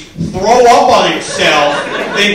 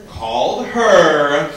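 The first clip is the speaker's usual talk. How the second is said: ordinary delivery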